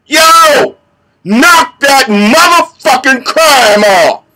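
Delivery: drawn-out, high roar at full force; intimidating voice. Shown as a demonstration. Vigilante showing a second warning to criminals, intimidating roar. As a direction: roar slowly across the street at a crook. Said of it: "how about this one?".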